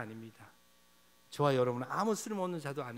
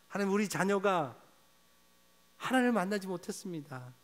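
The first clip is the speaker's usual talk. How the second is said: crying out; 5 semitones higher than usual. Pretend. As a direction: cry it out to God. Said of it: "탄식하기를 원합니다".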